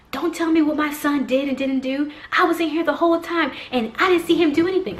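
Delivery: high, indignant mock tirade; irate mother. Irate mom defends her son to the other mom, indignant. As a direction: imitate a mom angrily arguing back. Retold mother argues back.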